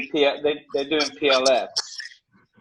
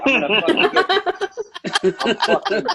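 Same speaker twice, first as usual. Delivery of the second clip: mock rage; 6 dB louder than usual. Mock rage at Scott Grimes.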